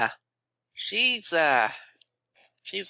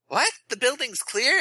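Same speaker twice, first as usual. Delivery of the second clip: high, startled nervous question; nervous surprise; 12 semitones higher than usual. Acted. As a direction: squeak it out in alarm. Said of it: "Nervous Rex says".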